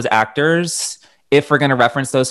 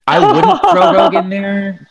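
laughing; 13 semitones higher, 4 dB louder than usual. Real, not acted. Laughing disagreement with cohost.

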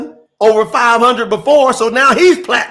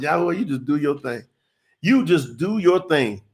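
soft aside to God; 5 semitones lower, 6 dB quieter than usual. Real, not acted. Quietly speaking to God.

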